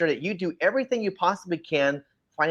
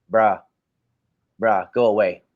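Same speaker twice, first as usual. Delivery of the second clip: dismissive bruh; 5 semitones lower, 4 dB louder than usual. Real, not acted. Dismissive rant punch.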